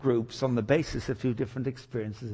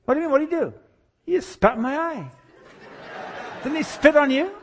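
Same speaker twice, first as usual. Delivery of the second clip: high, astonished back-and-forth; hammed-up bewilderment; incredulous exchange; 11 semitones higher than usual. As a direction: squeak it out in comic disbelief. Acted bewildered exchange about the healing.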